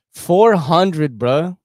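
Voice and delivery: loud outrage. Real, not acted. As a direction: blurt the price in real outrage. Repeating the shocking price.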